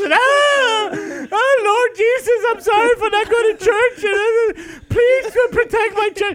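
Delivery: high, loud mock sobbing prayer; crying prayer. Imitating passengers crying prayers in a falling plane. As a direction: beg Jesus in a tearful panic. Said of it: "Everybody else".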